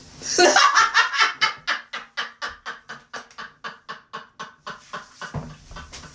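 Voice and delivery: very long high cackle, trailing into wheezes; laugh. Real, not acted. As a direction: laugh until out of air. Cracks up at being called con artist.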